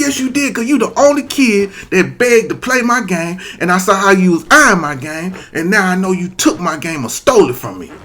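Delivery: put-on high, loud kid yelling blame; shouting at the thief; angry accusation. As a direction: accuse him hotly.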